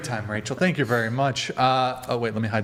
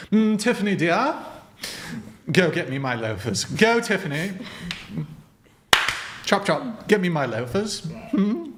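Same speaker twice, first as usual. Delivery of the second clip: haughty received pronunciation; upper-crust British accent; 5 semitones higher than usual. Acted. Master sends Tiffany for his loafers, upper-crust British accent. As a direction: order the servant about in posh British. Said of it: "upper crusty British received pronunciation accent".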